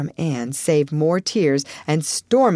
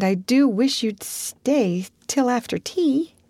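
put-on girlish pleading; 6 semitones higher than usual. Anne pleading with Diana.

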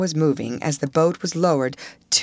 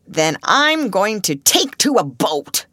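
slow, higher-pitched grouchy outburst; mildly raised, deliberate declaration; exclaiming grouch; 5 semitones higher, 4 dB louder, slower than usual. A narrator's reading. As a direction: exclaim it emphatically, stretching the words. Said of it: "exclaimed the Grouch".

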